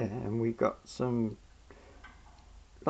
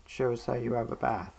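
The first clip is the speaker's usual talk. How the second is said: ordinary delivery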